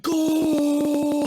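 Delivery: long, high, loud cry of delight; mock kid-on-Christmas shriek, drawn right out; excited yell. Four-year-old opening a Game Boy, excited yell.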